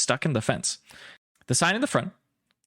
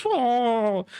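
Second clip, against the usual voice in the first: a high, distressed little creature "Oh"; creature whimper; 11 semitones higher than usual. Acted. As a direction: whine like a trapped pet pokemon. Creature grows frustrated again.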